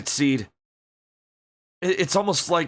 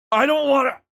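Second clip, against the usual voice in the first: high, louder frightened whine; frightened refusal; 12 semitones higher, 4 dB louder than usual. Acted. Billy refusing Seed's comfort, frightened. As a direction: refuse in terror.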